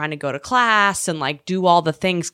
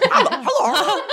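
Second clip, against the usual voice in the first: high, laughing sweet hello; sweet Canadian greeting. Retold or imitated. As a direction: chirp a naive hello, pitched high. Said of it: "still with her accent".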